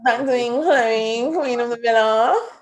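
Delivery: high, nasal drawl; Chloe's nasally voice. Acted. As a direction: put on a loud, slow, nasally whine. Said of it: "that nasally one. People say it sounds like".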